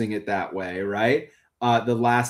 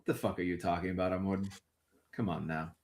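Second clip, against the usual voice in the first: low, quiet scolding; pissed off; 5 semitones lower, 12 dB quieter, faster than usual. Acted. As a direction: keep the annoyance low and soft. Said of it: "pissed off".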